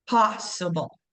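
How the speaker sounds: "possible" with a strong POSS, weaker ending; stress on first syllable. Shown as a demonstration. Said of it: "saying a little bit louder".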